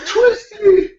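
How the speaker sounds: high wailing cry of the name, mock sob; imitating crying teen. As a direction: wail it like a heartbroken sixteen-year-old. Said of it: "was literally crying".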